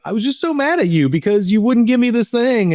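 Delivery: put-on a young girl, upset; mad little girl. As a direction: play the cross little daughter. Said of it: "she just was able to say".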